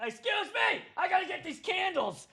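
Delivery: very high-pitched mock vendor voice; mock an intrusive vendor, squeaky and insistent; pushy vendor. Imitates pushy vendor clearing tables.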